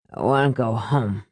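put-on low, slurred drunken whimper; mumbled low whine. A narrator's reading. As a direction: whimper it like a drunk wanting home. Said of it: "his father whimpered".

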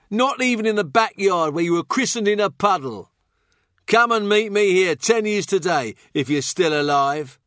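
high-pitched, mocking jester; goading fool's voice, pitched well up; taunting fool. A narrator's reading. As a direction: needle him in a high, jeering voice.

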